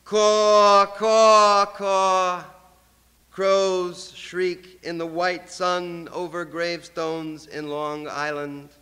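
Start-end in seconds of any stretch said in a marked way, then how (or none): 0.0-2.4 loud, harsh imitated crow cry, fading on the last; crow caw. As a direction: cry out like a crow over graves. Poet cries like a crow.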